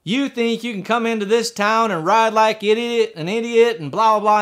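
high-pitched, indignant tirade; mock scolding from the bench; railing judge. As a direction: rail at him like an outraged judge. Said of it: "just rails into me".

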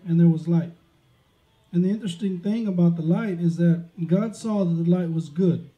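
ordinary delivery

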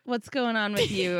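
ordinary talk

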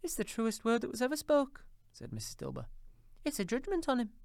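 put-on high, eager old woman agreeing; high gossipy old woman; Missus Dilber. A narrator's reading. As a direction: chime in like a gossiping laundress.

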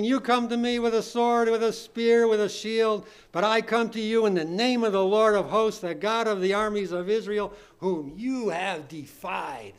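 loud, ringing Bible reading, slowing at the close; declaimed scripture challenge. Real, not acted. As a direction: proclaim it as a fearless challenge. Reads David's challenge from scripture.

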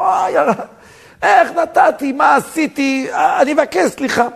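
high, wailing outburst of remorse; frantic apology. Retold or imitated. Man who lost all composure begging pardon, high frantic wail. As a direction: beg forgiveness in a high, drawn-out cry.

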